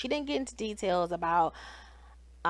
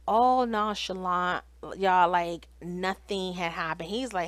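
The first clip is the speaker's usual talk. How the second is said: ordinary delivery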